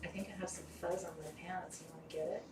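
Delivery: soft, hushed try at sounding sultry; failed seductive. Acted. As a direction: attempt to sound sexy that falls flat. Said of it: "that was supposed to be sexy".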